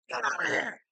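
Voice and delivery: loud, high angry yell; grandmother yelling. Retold or imitated. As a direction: bark it like a fed-up grandmother. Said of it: "would yell at me".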